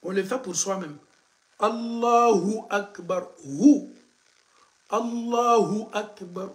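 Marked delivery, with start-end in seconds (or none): 1.6-6.5 "Allahu Akbar" slow with a punched "Hu"; slow model recitation. Shown as a demonstration. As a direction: repeat slowly, hitting "Hu". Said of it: "répétez ça pour bien prononcer".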